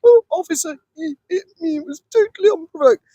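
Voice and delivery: high, whiny fake-hurt plea; mock victim whine. Jeremy Vine whining to the officer after a slap, mock-tearful. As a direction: play the wounded innocent to the officer. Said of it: "He goes".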